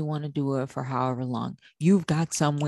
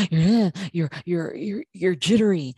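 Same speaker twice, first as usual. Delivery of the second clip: higher, louder, stumbling repeats; jittery; 4 semitones higher, 4 dB louder than usual. Shown as a demonstration. Coach demonstrating a stressed mom's nervous system, higher and stammering. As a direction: act out frazzled nerves, tripping over words. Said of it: "you're talking a little bit faster".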